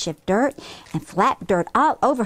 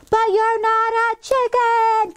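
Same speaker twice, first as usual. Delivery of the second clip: high, strained scream held in check; screamed protest; 13 semitones higher, 3 dB louder than usual. Acted. Kim screaming at her chicken sister, high protest. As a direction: scream it high, drawing out the words. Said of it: "Kim screamed".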